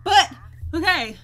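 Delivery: normal talking voice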